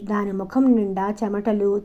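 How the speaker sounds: ordinary delivery, nothing marked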